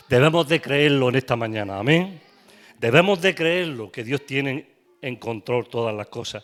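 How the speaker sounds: loud, driving call to believe; fervent preaching. Acted. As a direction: exhort the congregation at full voice. Preaching loudly. (predicación fervorosa en voz alta)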